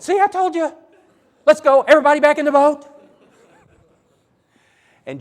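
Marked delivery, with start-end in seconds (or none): 0.0-2.9 high, loud frightened yelling, rushed; panicked shout. Acted. Apostles panicking at the demon-possessed man, shrill high shouting. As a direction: yell in panic to get everyone back aboard.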